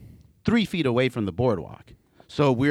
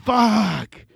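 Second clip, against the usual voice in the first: alarmed curse; 10 semitones higher, 4 dB louder than usual. Retold or imitated. Sees gushing blood.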